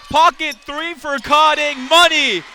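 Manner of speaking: excited three-point call. Real, not acted. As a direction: punch it out like a big bucket. Live call of a made three.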